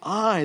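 "I" punched high and loud; stress on I. Shown as a demonstration. Preacher showing the emphasis on God's "I", stressed. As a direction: hit the word "I" hard. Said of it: "the personal pronoun "I" is added for emphasis".

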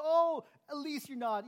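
high, loud, slow mock reassurance; mock brush-off. Shown as a demonstration. Pastor parodying careless comfort, high and loud. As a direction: chirp it out, dismissing the pain.

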